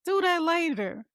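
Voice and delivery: high, slow, easygoing voice of God; mock divine voice telling her to leave it; voicing God. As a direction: play God gently waving it off, up high and slow. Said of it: "He's like".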